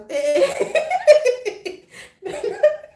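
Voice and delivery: high loud laughing fit; real helpless laughter; shrieking laugh. Real, not acted. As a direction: laugh until it squeaks. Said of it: "I'm laughing too much".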